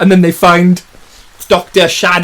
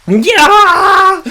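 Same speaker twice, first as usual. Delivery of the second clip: loud, high drawn-out yell; acted scream; 5 dB louder than usual. Man under attack by a dinosaur, screaming.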